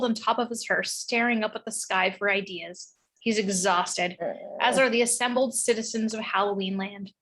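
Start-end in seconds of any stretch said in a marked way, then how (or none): none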